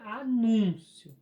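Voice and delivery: anúncio slowed, with NÚN stretched; slow a-NÚN-cio, middle syllable strong; stress on NÚN. Shown as a demonstration. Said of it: "Então, acento".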